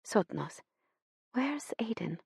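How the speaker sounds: put-on whispered Swedish-accented mother; gentle Swedish lilt, almost whispered; a hint of Swedish accent, hushed; soft Swedish accent. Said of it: "in her soft Swedish accent".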